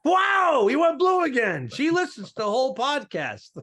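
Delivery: high-pitched, loud playful protest; mock scandalised outburst. Podcaster calling out co-host's blue joke, mock scandalised. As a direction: cry out as if shocked.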